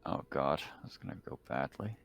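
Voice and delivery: normal talking voice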